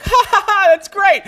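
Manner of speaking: hammed-up high fake laughter. Retelling their careless laugh on air about the guy, mock laugh. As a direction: cackle high and loud like a host covering.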